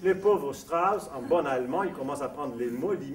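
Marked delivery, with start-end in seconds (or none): none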